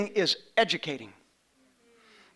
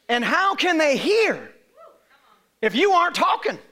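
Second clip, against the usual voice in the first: preaching at full force; 5 semitones higher, 4 dB louder than usual. Acted. Pastor pressing the verse on his church, preaching loud. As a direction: lift the verse up to the congregation.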